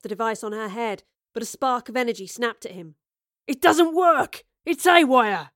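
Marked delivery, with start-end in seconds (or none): 3.5-5.5 very loud, high, frantic shout. A narrator's reading. Leader as the device goes haywire, shouting. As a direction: shout in alarm as it fails. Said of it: "the leader was shouting".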